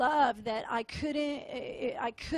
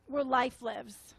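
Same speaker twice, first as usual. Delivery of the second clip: normal talking voice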